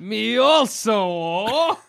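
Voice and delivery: high, very drawn-out orc voice; Italian-sounding orc. Acted. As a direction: stretch it out as a hammed-up Italian orc. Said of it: "Why are orcs Italian".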